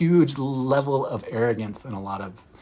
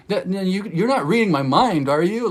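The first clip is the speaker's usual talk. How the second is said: mock nervous; 6 semitones higher, 5 dB louder than usual. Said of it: "she even nervously was like".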